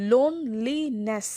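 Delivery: Loneliness slowly by syllable, লোন weighted; a strong, drawn-out লোন opening loneliness; stress on first syllable. Shown as a demonstration. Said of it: "বেশি stress".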